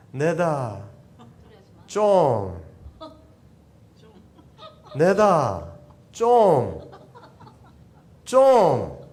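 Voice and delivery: loud, high Gyeongsang dialect, half laughing. Shown as a demonstration. Pastor shows the congregation Jesus in Gyeongsang dialect, loud and high. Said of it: "경상도 사투리로 한번 해볼까요".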